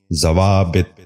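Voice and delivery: ضوابط ending in "-bit", "za-waa-bit"; correct pronunciation. Shown as a demonstration. Teacher giving viewers the model, correct pronunciation. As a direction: say ضوابط as zawaabit. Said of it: "غور سے سنیے".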